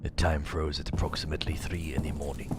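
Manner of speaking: hushed whisper, quiet and eerie. Acted. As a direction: whisper it like a secret. Reading a note, whispered.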